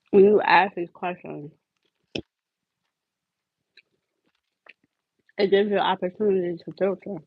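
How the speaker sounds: talking around ice in mouth; words slurred by ice in mouth. Real, not acted. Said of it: "I'm trying to talk with ice in my mouth".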